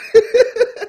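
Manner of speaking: loud, high outburst of laughter; burst of laughter. Real, not acted. Guest laughing after God takes over, loud high laugh. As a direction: crack up at your own punchline.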